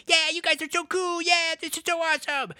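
high, gushing mock admiration; cheering fans. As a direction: cheer as awestruck onlookers. Voices cheering ranchers.

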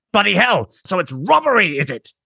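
high, loud furious outburst; outraged exclaim. Acted. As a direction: explode in outrage. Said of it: "exclaims the commander".

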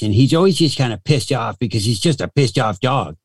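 put-on voice of a randy, disgruntled dog; perverted corgi voice. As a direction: talk as a pissed-off, pervy little corgi. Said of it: "so the corgi sounds like this".

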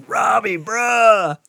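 high, slow drawn-out appeal; exasperated calling out. Retold or imitated. Guard finds his friend in women's bathroom, dismayed call. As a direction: stretch both words high, imitating a dismayed buddy.

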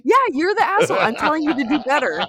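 high mock-angry scolding; playful telling-off. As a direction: scold hard, on the edge of laughing. Scolds partner, about to laugh.

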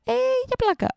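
very high, mock innocent squeak; squeaky excuse. Mandi's friend dodging blame, squeaky high excuse. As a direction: shrug it off in a tiny high voice. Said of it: "Και ήταν σε φάση".

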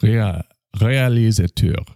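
start, restart, then stretch it in French; attempted French accent. Shown as a demonstration. Host testing himself on a French word, attempted French accent. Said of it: "see if you can do a French accent".

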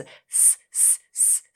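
voiceless S, just a stream of air pushed forward; hissed S. Shown as a demonstration. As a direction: push air out on a soft hiss. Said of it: "空気出す、前に出す".

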